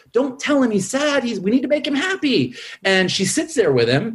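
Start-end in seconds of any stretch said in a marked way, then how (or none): 0.1-2.6 high, frantic cartoon voice, half-hammed; mock panic, pitched way up; frantic Joy. Host imitating Joy scolding Sadness, high and frantic. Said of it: "And Joy is like".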